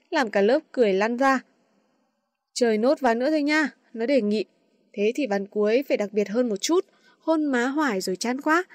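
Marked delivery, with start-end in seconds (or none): none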